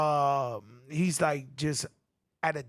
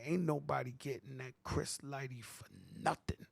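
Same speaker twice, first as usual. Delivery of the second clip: low, unvoiced whispered warning; hushed retold threat; 5 semitones lower, 15 dB quieter than usual. Telling his crew to keep away from Chris, a whispered threat. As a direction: breathe the threat out, barely voiced.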